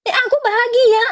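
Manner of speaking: mock crying believer.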